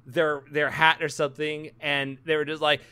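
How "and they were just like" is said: normal talking voice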